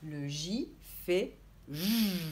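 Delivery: "J" said slowly as a long buzzing zh; sound demo. Shown as a demonstration. Teacher models the J sound slowly for children. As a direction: stretch the final "J" into a clear zh buzz. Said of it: "Tu dois entendre le son".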